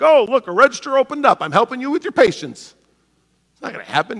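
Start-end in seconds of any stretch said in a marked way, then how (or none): 0.0-2.7 mock God voice. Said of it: "God's gonna be like".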